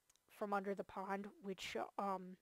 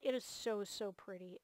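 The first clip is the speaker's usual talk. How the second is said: ordinary delivery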